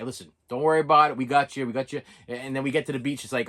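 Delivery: normal talking voice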